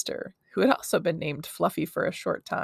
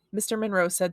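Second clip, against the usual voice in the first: ordinary delivery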